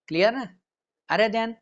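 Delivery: usual speaking voice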